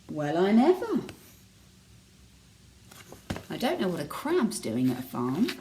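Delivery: ordinary delivery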